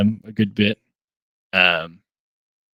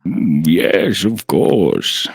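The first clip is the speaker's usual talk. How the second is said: put-on old man voice; 3 semitones lower, much slower than usual. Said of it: "like, the old man voice".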